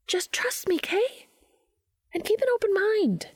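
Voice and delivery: very high, light pleading voice; urging trust. A narrator's reading. Charlie asks Dean to trust her, high-pitched urging. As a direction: urge him in a very high voice.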